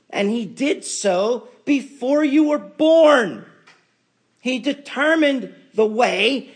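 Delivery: fiery loud high preaching; preaching at full force. Acted. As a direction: preach it with rising fire.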